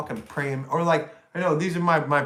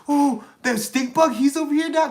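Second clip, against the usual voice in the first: high mock-surprised voice; mock surprise; 9 semitones higher than usual. Streamer mocking viewers noticing the rival, high.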